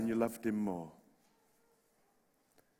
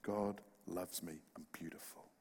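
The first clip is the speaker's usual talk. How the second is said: soft mirror words; 6 semitones lower, 11 dB quieter than usual. Retold or imitated. Pastor shows the young woman what to say to herself, hushed. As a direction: breathe it like a secret affirmation. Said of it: "you write on the top of your mirror".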